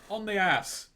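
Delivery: put-on London-accented "On the ass". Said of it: "you do sound very posh".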